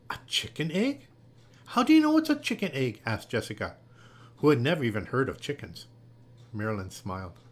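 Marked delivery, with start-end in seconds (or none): 0.1-3.0 puzzled, then high and loud; astonished question. A narrator's reading. Jessica, who never heard of chickens, asks Marilyn, astonished. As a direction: blurt the question in disbelief.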